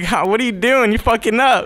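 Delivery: loud, high-pitched mock scolding; incredulous scolding. Acting his incredulous reaction in story.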